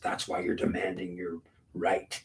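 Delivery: usual speaking voice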